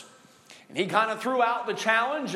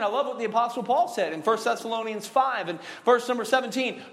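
ordinary talk, nothing marked

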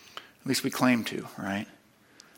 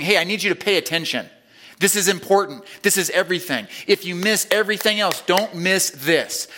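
preaching; 4 semitones higher than usual. Acted. Preacher urging his congregation not to miss this, building intensity. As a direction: press it on the congregation.

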